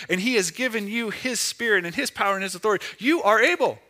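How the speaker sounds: triumphant Holy Spirit voice, building in force; rising proclamation. Pretend. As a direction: preach it with growing fire. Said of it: "the Holy Spirit who says".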